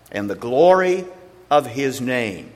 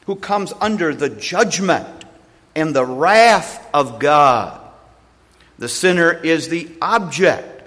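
louder and higher, preaching with rising force; building sermon; 4 semitones higher, 5 dB louder than usual. Acted. Preacher warns the church what a sinner is, swelling louder. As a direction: drive it home to the congregation.

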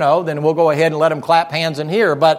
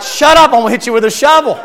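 higher, louder, fast comic threat; mock angry snap; 6 semitones higher, 6 dB louder, faster than usual. Grieving preacher snapping at the neighbor, fast loud mock threat. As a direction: bark it back in jest. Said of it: "I'm like".

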